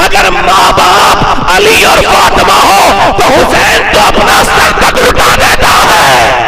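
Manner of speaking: high shouted sermon climax, slowing; fervent shouting preacher, drawn out; preaching at full force. Acted. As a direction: cry it out to the crowd.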